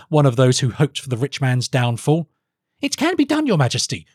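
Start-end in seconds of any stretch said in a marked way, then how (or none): 2.8-4.1 high-pitched urgent protest; pleading cry to a king; alarmed. Acted. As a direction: insist in alarm, voice jumping up high. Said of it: "cried Felix, alarmed".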